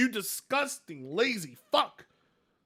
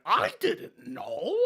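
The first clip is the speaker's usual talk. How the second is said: mock surprise; 5 semitones higher, 4 dB louder, much slower than usual. Mock-playing the creator, amazed.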